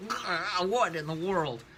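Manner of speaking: high-pitched, hammed-up bewildered yelp; alarmed cry. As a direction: cry out as if violently shaken. Shaken possessed man cries out.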